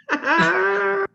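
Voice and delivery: sudden high loud laugh; high burst of laughter. Real, not acted. Laughing at a joke.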